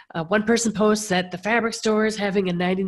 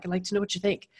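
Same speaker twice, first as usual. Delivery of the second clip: ordinary talk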